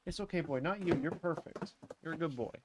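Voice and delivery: soft, reassuring dog talk; soothing a pet. Real, not acted. Soothes their dog after yelling.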